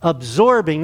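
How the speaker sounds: ordinary talk, nothing marked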